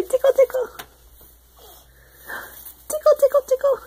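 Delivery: very high squeaky tickle chant; giggly tickle attack. Real, not acted. Tickling the baby.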